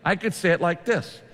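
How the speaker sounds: ordinary talk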